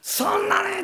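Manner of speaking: high sharp comeback; retorting shout. Retold or imitated. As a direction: bark it back at the son. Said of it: "なんでそんな声を出すと".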